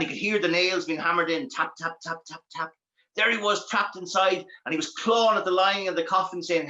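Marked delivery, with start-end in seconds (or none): none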